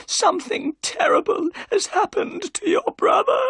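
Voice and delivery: put-on panicked old housekeeper; frightened cry. A narrator's reading. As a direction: blurt out the terrible news. Housekeeper cries out the news.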